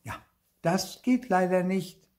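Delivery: put-on high-pitched child's objection; high, smug refusal; contradicting. A narrator's reading. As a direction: object in a know-it-all child's voice. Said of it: "widersprach Clara".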